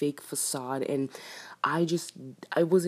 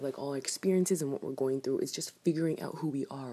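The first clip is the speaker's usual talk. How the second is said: ordinary talk, nothing marked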